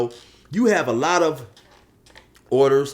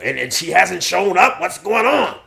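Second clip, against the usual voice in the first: loud, higher questioning bro; Polar Bear; 6 semitones higher, 6 dB louder than usual. Acted. Buddy quizzing Josh about Heather, loud puzzled bro. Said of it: "And then Polar Bear says".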